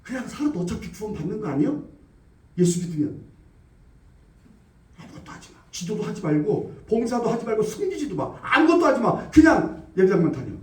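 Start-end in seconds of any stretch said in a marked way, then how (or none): 5.0-5.6 hushed, voiceless whisper; whispered temptation. Acted. (유혹하는 속삭임)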